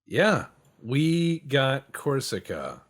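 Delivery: triumphant. Real, not acted. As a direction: savor the win, slow and loud. Captured Corsica in game.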